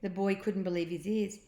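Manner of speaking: ordinary delivery, nothing marked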